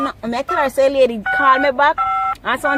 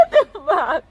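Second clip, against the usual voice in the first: laughing; 9 semitones higher, slower than usual. Real, not acted. Laughing at the prank.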